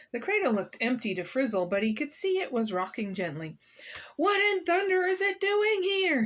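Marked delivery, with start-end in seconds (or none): 4.1-6.2 high, raised storybook bellow; hot-tempered man's outburst, pitched far up; angry shout. A narrator's reading. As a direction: shout the question in outrage. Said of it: "he shouted".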